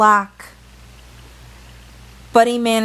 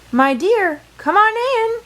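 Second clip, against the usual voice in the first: high sweet falsetto greeting, much higher than the narration; the wolf pitching way up to sound like a kindly grandma; wolf faking granny; 12 semitones higher than usual. Acted. Wolf poses as granny at door.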